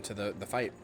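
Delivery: normal talking voice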